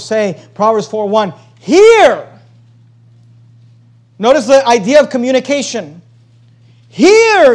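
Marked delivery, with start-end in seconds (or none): 1.6-2.3 a sudden high, loud cry of the first word; emphatic call. Acted. Preacher reads the verse's opening command. 6.9-7.5 a sudden high, loud cry of the first word; emphatic call. Acted. Preacher repeats the verse's command.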